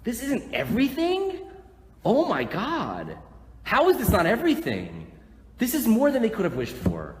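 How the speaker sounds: loud, high, stretched-out disbelief; incredulous outcry. Real, not acted. Preacher answering doubters that this is everything, incredulous outcry. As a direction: throw the doubt back, astonished and loud.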